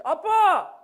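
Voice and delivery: put-on loud child's cry for Dad; calling for dad. David looks for dad from halfway up, calling loudly. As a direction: call out loudly for your father. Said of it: "데이빗이 아빠를 찾아".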